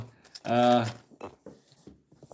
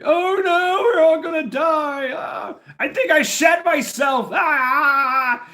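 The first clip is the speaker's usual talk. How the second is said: hammed-up high panicked shriek, much louder; mock panic; 6 dB louder, slower than usual. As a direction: shriek like you're sure you'll die, as a joke. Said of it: "We're like".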